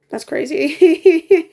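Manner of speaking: slow, loud laughing crazy. Real, not acted. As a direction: laugh through the words slowly. Laughing crying at the absurdity.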